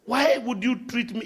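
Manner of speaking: usual speaking voice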